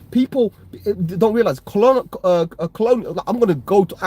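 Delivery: indignant. Real, not acted. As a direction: fire the question back in real outrage. Heated rant at racist chatter.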